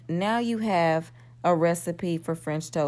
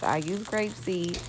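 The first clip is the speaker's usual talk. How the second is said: ordinary talk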